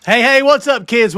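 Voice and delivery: loud, high, hyped welcome; excited greeting. Real, not acted. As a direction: hype up the audience. Host calling out greeting.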